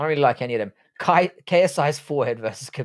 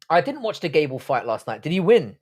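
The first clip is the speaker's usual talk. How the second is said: ordinary talk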